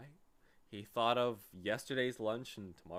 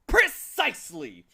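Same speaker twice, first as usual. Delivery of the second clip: high, loud, slow emphatic shout; 14 dB louder, slower than usual. Acted. Watchdog shouting agreement at Milo, high and loud. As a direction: bellow the word with triumph. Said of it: "shouted the dog".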